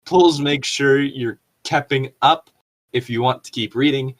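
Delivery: ordinary talk, nothing marked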